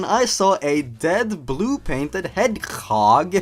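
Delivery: ordinary talk, nothing marked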